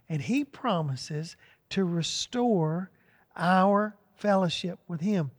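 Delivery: ordinary talk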